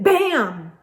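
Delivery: usual speaking voice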